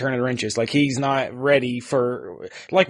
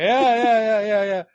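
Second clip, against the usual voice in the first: dismissive, higher string of yeahs; sarcastic yeahs; 8 semitones higher than usual. Retold or imitated. Son inwardly brushing off his dad's rant, sarcastic yeahs. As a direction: brush him off with a run of yeahs. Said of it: "I'm thinking".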